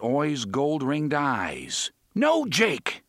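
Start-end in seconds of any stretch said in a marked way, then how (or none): 2.1-3.0 high, loud desperate bellow. A narrator's reading. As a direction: roar out the warning. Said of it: "Roland and Eddie bellowed together".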